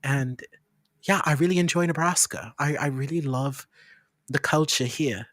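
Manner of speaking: easygoing, polite posh British; put-on posh British accent. Londoner telling server about moving to Nebraska, posh British accent. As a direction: keep up the British newcomer act. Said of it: "This is the way I talk".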